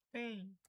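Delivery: "Pain" with an "ay" vowel; A sounds like E. Shown as a demonstration. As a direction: say "Pain" as "pein". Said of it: "o A com som de E". ("Pain" com A soando como E)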